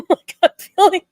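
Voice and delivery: bursting into a high, loud laugh. Real, not acted. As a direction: laugh it out at yourself. Laughs at feeling crazy.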